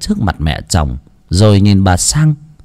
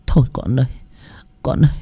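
tearful; 8 semitones higher than usual. Acted. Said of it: "nước mắt lưng tròng".

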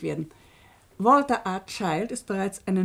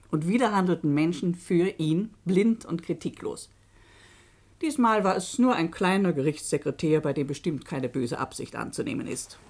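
ordinary delivery

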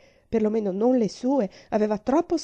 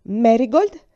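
louder, firm call of the name; 3 semitones higher, 10 dB louder, slower than usual. Acted. Aunt calling the panicking girl to order, firmly. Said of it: "disse con fermezza".

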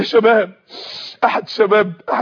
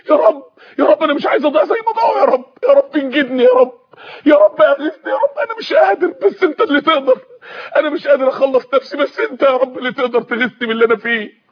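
tearful pleading prayer; 2 semitones higher than usual. Acted. Young man crying out to God for rescue, tearful pleading. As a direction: beg God for rescue, voice breaking and rising.

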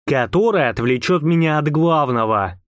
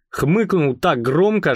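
ordinary delivery

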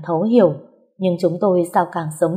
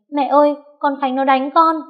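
crying out; 8 semitones higher than usual. A narrator's reading. Narrator voices sister-in-law calling her mother for help, crying out. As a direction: call to mum, pitched well up. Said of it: "nó la lên".

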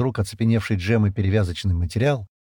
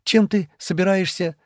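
higher, anxious questioning; worried; 10 semitones higher than usual. A narrator's reading. Шира asks Стэн how he will cut, anxious. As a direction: ask with growing unease. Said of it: "беспокойно спросила она".

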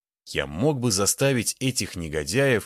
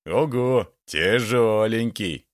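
gloating; 2 semitones higher, 4 dB louder, slower than usual. A narrator's reading. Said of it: "осклабился он".

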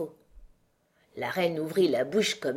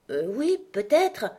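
slow, hesitant, careful agreement; cautious; 6 semitones higher, 3 dB louder than usual. A narrator's reading. Alice answers the queen's question about the dog, cautiously. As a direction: agree warily, stretching it out. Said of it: "dit Alice prudemment".

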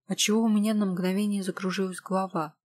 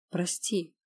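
ordinary delivery, nothing marked

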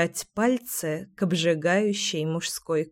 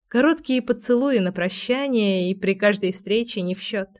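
ordinary delivery, nothing marked

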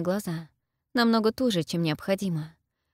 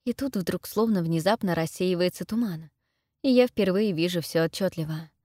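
ordinary delivery, nothing marked

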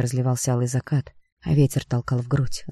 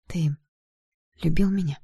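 higher, hesitant, fragile question; choked up; 3 semitones higher than usual. A narrator's reading. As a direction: ask haltingly past a lump in the throat. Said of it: "С трудом проглотив ком в горле".

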